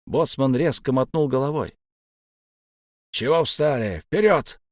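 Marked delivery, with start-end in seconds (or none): 3.1-4.6 urging command, last word high; shouted order. A narrator's reading. Officer driving stalled men forward, shouting. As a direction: push them on, rising to a shout.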